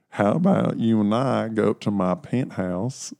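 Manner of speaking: slow, drawled seduction in a put-on accent. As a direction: make your pitch slowly in a fake accent. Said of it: "penthouse with that accent".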